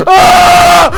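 high, loud scream of fright; startled scream. Real, not acted. Player jump-scared in a horror game, startled scream.

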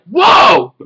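high, loud shouted whoa; startled high yelp; shocked shout. Retold or imitated. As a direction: yell it out in shock. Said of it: "And I'm like".

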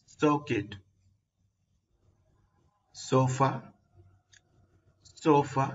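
usual speaking voice

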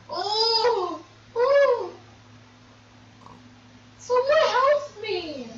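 high, loud frightened yelps; real alarm, calling out for rescue; panicked cry for help. Real, not acted. Player spooked by attacking dogs, crying out for help in a high, loud panic.

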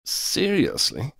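high, drawn-out questioning; slow, doubtful query; incredulous question. A narrator's reading. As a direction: stretch it out in high-pitched disbelief. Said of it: "Corbin questioned".

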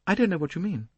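defensive. A narrator's reading. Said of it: "The girl flushed angrily".

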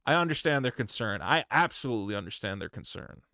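soft, low, contrite apology; scripted humble statement; calm sincere apology. Pretend. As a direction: say it like you truly mean it. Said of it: "you should go".

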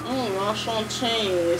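slow, hammed-up French accent on "Mm, enchanté,"; mock French accent. Shown as a demonstration. Gamer mocking what she can't do, a slow, hammed-up French accent. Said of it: "the French accent, I can't do it".

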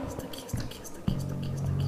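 frantic whisper, voiceless. Real, not acted. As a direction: breathe it out in panic. Hiding, enemy close.

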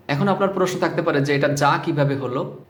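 normal talking voice

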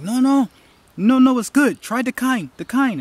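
high-pitched, eager buddy pushing food; insistent urging. Retold or imitated. Buddy urging a skeptical friend to try sushi, insistent.

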